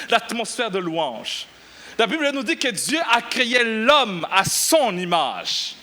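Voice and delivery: ordinary talk, nothing marked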